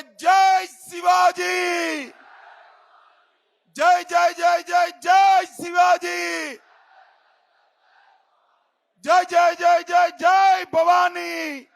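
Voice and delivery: slow, drawn-out chanted slogan; roaring war cry. Acted. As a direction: lead the crowd's war cry at full voice. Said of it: "Shivaji Maharaj का जयकारा लगाइएगा".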